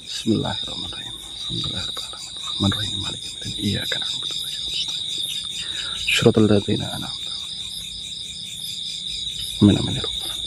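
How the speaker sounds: soft, unvoiced recitation of Arabic prayer; whispered prayer. Vlogger prays over the possessed man, whispered. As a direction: murmur the verses under your breath.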